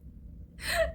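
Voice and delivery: high unvoiced sob; a short breathless sob; crying sob. Daughter overwhelmed by scolding, sobbing. Breaks down after protest.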